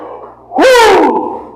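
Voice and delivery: high, loud wrestler's woo; Ric Flair woo. Retold or imitated. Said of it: "for the Ric Flair right here".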